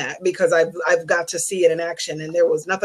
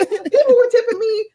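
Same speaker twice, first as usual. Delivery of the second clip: laughing amazement; 5 dB louder than usual. Real, not acted. Amazed at tips.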